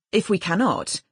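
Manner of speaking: ordinary delivery, nothing marked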